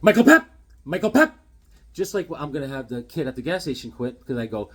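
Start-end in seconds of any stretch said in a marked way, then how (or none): 0.0-1.3 calling the name out high and loud, teasing. Retold or imitated. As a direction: needle a coworker by yelling his name. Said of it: "kept on saying his name like".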